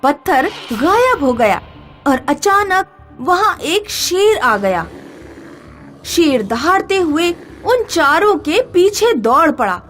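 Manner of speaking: ordinary delivery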